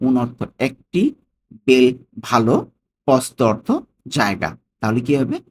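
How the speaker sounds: "Conosco", "un bel", "posto" set apart with pauses and glosses; broken into pieces. Shown as a demonstration. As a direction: teach "Conosco un bel posto" word by word, deliberately. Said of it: "ভেঙে ভেঙে আমরা উচ্চারণ করি".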